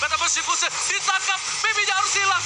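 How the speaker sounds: esports caster. Acted. Hyped play-by-play call.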